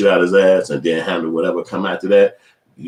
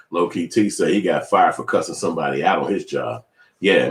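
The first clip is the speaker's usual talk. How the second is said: ordinary talk, nothing marked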